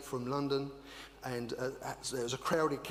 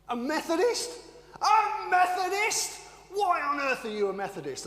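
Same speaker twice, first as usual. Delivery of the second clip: much higher and louder, incredulous preacher; outraged preacher; 8 dB louder than usual. Retold or imitated. Baptist preacher scandalized by a Methodist visitor. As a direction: shriek the word in disbelief. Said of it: "fiery Baptist preacher".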